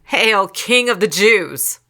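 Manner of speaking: slow, loud mock acclamation. A narrator's reading. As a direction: hail him in mockery, drawing it out. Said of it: "and mocked him".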